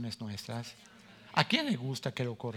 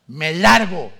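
much louder, sharp exit line; storming off; 4 semitones higher, 17 dB louder, slower than usual. Retold or imitated. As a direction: blurt it out like slamming the door. Storming out of church.

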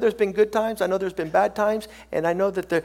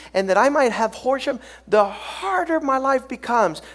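full-force preaching; 3 semitones higher, 5 dB louder than usual. Real, not acted. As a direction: build each phrase and drive it home.

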